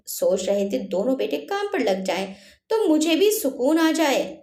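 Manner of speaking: ordinary delivery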